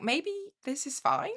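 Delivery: unsure small voice. Retold or imitated. Listener voices self-doubting victim.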